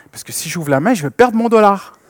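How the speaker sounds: put-on a small child afraid to lose her dollar; little girl's worry. Daughter explaining to her father why she won't open her hand.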